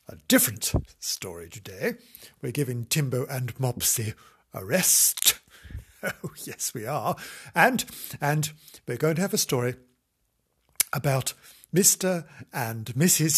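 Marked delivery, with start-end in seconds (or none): none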